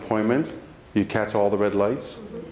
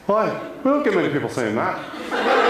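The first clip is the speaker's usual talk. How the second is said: surprised laugh. Retold or imitated. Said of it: "she goes".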